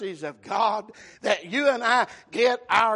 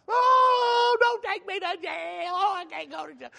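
high, wailing fake sobs; mock crying; 8 semitones higher than usual. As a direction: wail and plead, hammed-up. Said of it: "you'll see them start crying".

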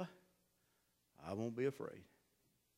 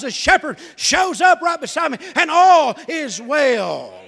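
high, loud climbing preacher's cry; fervent revival preaching, high and loud; preaching at full force; 12 semitones higher, 17 dB louder than usual. Real, not acted. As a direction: lift it to a shouting sermon peak.

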